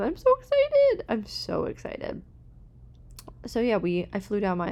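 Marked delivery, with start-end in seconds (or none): 0.0-1.0 much higher, louder excited squeal; squealing excitement. Real, not acted. 1.1-2.2 slow excited whisper; whispered excitement. Real, not acted.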